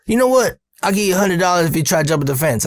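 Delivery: high, quick boss impression; imitated teasing wager; Earl daring. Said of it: "And he goes".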